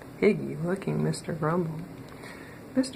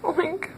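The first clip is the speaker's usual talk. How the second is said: much higher wailed pig oink; mournful wailing oink; 11 semitones higher than usual. Acted. Mister Grumble as a pig wailing mournfully. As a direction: wail an oink like a sad pig. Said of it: "wailed Mister Grumble mournfully".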